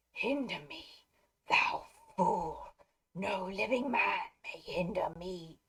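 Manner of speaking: slow, hoarse whisper of the Nazgul; creepy wraith whisper. Acted. The Nazgul threatening Dernhelm, in a slow eerie whisper. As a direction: breathe the threat like a ghost. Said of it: "when I talk like that".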